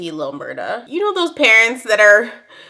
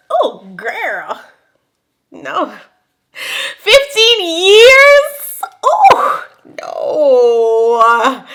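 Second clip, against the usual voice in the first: high, loud, slow mock-crying wail; 5 semitones higher, 5 dB louder, much slower than usual. Horrified by fifteen years without sex, mock-wailing. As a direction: wail it like it's a tragedy.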